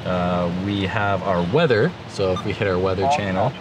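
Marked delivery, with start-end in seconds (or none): none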